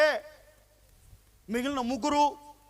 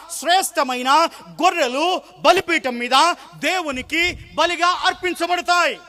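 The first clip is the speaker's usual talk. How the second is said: emphatic preaching; 5 dB louder, slower than usual. Acted.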